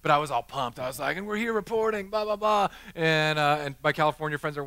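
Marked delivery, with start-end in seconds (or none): 1.2-2.7 put-on Australian accent. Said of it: "I did this Australian accent".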